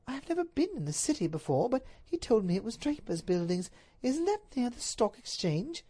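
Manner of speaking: high lady's voice; high-voiced puzzled wife; bewildered Duchess.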